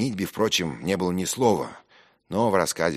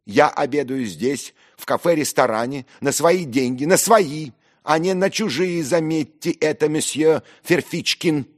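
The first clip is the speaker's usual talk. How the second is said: high, loud, emphatic tirade; affronted shouting at a rival; indignant outburst; 13 semitones higher, 8 dB louder than usual. Acted. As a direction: drive each point home furiously. Narrator's outburst continues at dinner.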